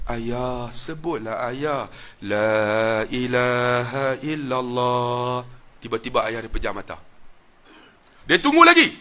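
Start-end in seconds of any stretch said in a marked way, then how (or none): 0.1-5.4 soft, slow, sorrowful plea drawn out into a chant; whisper-soft plea at a dying father's ear, slowly sung; sad, pleading tone. Retold or imitated. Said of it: "dia buat nada sayu".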